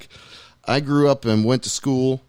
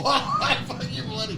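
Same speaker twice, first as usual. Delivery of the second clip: high-pitched, softer parroting of the Indian man's cursing, breaking into a laugh; laughing mimicry; 13 semitones higher, 6 dB quieter than usual. Host laughingly echoes the Indian man's curse, high and soft. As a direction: laugh while echoing the clip's curse back.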